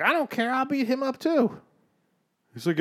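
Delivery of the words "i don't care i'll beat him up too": high-pitched bratty child imitation; defiant little kid. Imitating neighbor's little kid threatening him to dad, high and defiant. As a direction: pitch it way up like a small boy boasting.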